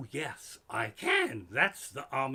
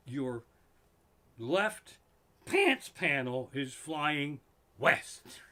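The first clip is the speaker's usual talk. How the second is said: slow deadpan phrasing; much slower than usual. Shown as a demonstration. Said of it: "what you actually should say is".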